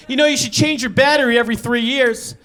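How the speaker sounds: a hint of a condescending neighbor lecturing; mild mock smug advice.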